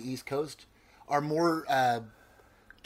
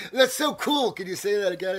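loud, high gushing outsider; hammed-up fascination; mock delight; 6 semitones higher, 5 dB louder than usual. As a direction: imitate someone thrilled by an accent. Mimics outsiders delighted by the accent.

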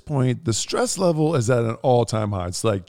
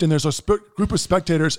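ordinary delivery

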